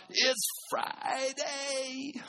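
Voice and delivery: high, slow drawn-out yell. Retold or imitated. Preacher crying out to thousands, high and drawn-out. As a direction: stretch it out like a preacher's cry. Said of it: "he just yelled out".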